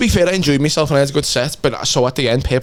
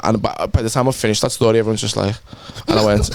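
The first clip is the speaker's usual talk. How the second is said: normal talking voice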